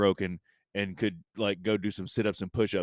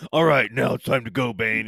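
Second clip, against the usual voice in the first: loud, slightly raised mock bravado; mock heroic Batman; 2 semitones higher, 7 dB louder than usual. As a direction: play a loudly determined hero. Acts out recovered Batman, jokingly.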